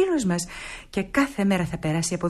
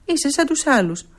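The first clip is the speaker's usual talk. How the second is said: louder, higher, fast indignant outburst; hurt pride bursting out; offended protest; 5 semitones higher, 5 dB louder than usual. Acted. Nora protests that everyone thinks she is useless.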